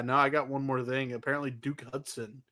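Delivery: ordinary talk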